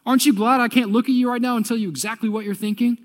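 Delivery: high, loud startled outburst; shocked whoa. Acted. Religious leaders shocked Jesus reads their thoughts, high startled yelp. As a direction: yelp in alarm, voice jumping up. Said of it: "and they're going".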